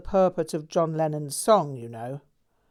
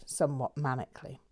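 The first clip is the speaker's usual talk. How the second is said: ordinary delivery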